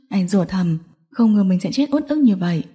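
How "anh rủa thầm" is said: ordinary delivery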